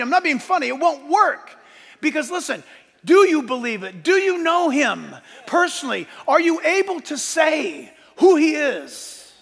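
loud, high, urgent pulpit exhortation; impassioned sermon at shouting pitch; full-force preaching. Acted. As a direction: press the congregation hard, punching key phrases.

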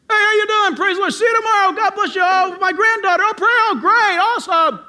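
high, loud rapid-fire chatter; mock boisterous church chatter; loud greetings. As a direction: holler hellos as if at home. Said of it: "Christians are loud people".

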